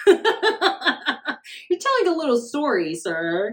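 high, slow, laughing tease; laugh, then draw the words out high; real laugh into a drawn-out tease; amused teasing. Real, not acted. Laughing at a made-up claim.

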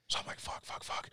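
whispered curses under the breath; panicked whisper. Retold or imitated. Retelling fear as moose stood by his tent, whispered curses. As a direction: hiss it so the moose can't hear.

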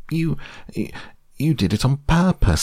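high sputter through soap. A narrator's reading. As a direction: splutter it out, mouth foamy. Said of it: "sputtered Piglet".

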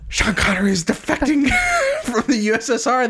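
high, laughing gasp of discovery; reliving the twist with a laugh; thrilled realization. Retold or imitated. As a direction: blurt it out amazed, laughing. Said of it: "And I was like".

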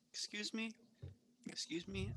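soft, hesitant excuse me fading to a whisper; softly whispered excuse me; timid. Acted. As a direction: tiptoe in and interrupt. Timid intrusion into office.

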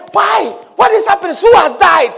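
high, urgent, loud asking who died; alarmed questions. Acted. Bystander rushing in to ask who died, loud and alarmed. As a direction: burst in asking what's wrong.

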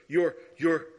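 woman in the bank accusing the preacher, building anger; accusing lady. Retold or imitated. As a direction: hurl it at him like a charge. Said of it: "She goes".

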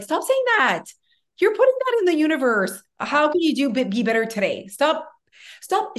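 high-pitched, exasperated friendly telling-off; exasperated pleading. Retold or imitated. Telling her friend to stop saying that, exasperated. Retold scolding of a negative friend.

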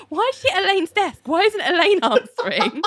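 high-pitched incredulous questioning; mock baffled complaint. Host reacting to Ali at Elaine's desk, mock baffled.

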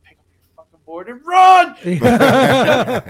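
loud, slow shout to run; panicked yell, words dragged out; yelled warning. Retold or imitated. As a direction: scream it at your brother to bolt. Yelling at brother to flee.

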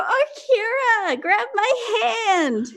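high, slow, pleading shout; desperate high call. Acted. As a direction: reach out and call, high and stretched. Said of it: "I'm gonna go".

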